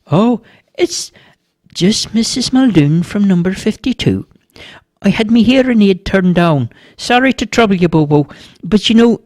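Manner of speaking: high-pitched apologetic neighbour woman; chatty old neighbour. A narrator's reading. Neighbour explaining the boy's parents are searching, apologetic.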